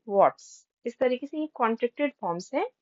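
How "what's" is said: normal talking voice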